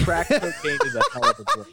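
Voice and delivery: high, laughing quote. Real, not acted. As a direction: blurt it out through laughter. Quoting meme through laughter.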